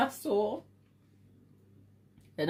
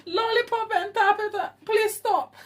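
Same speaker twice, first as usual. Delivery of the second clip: laughing plea; 10 semitones higher than usual. Real, not acted. Begging through laughter.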